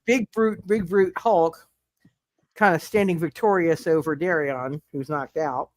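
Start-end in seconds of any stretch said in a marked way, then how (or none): none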